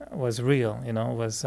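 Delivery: normal talking voice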